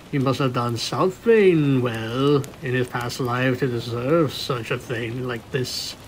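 usual speaking voice

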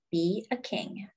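ordinary delivery, nothing marked

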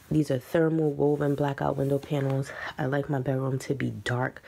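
normal talking voice

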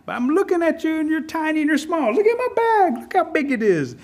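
high-pitched Rabbit, rising as he taunts; taunting Rabbit. A narrator's reading. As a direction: belittle the tiny ant in a climbing high voice. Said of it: "Rabbit looks at the queen ant and says".

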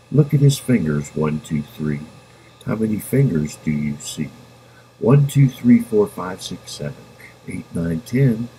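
plain reading voice

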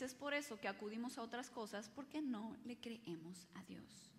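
hushed confession; whisper. Real, not acted. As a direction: whisper the truth gravely. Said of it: "whispered conclusion".

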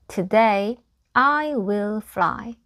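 "Today I will fly" very slow, each word separate; slow teacher's demonstration of "Today I will fly"; model pronunciation. Shown as a demonstration. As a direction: say "Today" as tuh-day, then draw out "I will fly". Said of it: "이렇게 한번 발음을 해보시고요".

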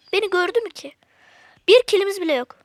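ordinary talk

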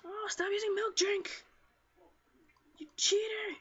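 high, loud gamer outrage; outraged yelling. Real, not acted. Player to a healing enemy, outraged yelling. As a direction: yell at a cheating opponent.

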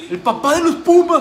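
high, loud gloating cheer; triumphant shout. Real, not acted. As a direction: crow over the rival. Fan celebrating the scorer.

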